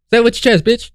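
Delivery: high-pitched goading jeer; taunting challenge. Real, not acted. Taunting the woman in the clip.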